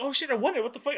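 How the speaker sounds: high-pitched, disbelieving excitement; shocked. Retold or imitated. Telling how he ended up winning it, shocked. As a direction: re-enact a stunned winner's outburst. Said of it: "I was, I was shocked".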